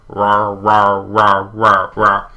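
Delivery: choked, sputtering mock roars like an engine failing; sputtering lawnmower roar. A narrator's reading. Glitching monster tries to roar at the boys, sputtering mock roar. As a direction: make the roar cough like a lawnmower that won't start. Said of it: "It sounded like a lawnmower trying to start".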